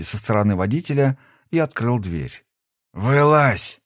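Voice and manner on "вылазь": hoarse smoke-worn bark; smoker's rasp. Acted. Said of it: "сиплым прокуренным голосом сказал он".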